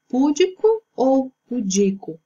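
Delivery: púdico with the stress first, pudico with the stress on di; stress contrast. Shown as a demonstration. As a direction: sound out púdico against pudico. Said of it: "a forma correta é pudico".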